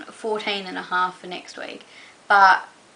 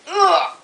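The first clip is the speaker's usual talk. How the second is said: ugh of disgust; 9 semitones higher, 8 dB louder than usual. Real, not acted. Reacts to dog.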